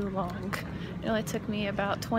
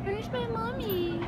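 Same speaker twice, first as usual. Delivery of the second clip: put-on much higher, slow whiny child voice; little kid voice; 12 semitones higher, 3 dB quieter, slower than usual. Vlogger looking for her mom, childish high voice. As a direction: sound like a lost little kid.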